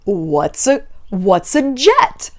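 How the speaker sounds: slow, drawn-out fake ignorance; playing dumb. Guilty person denying everything when confronted, exaggeratedly clueless. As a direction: stretch each question as if the word is foreign. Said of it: "a guilty person can also be like".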